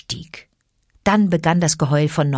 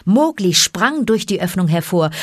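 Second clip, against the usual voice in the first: usual speaking voice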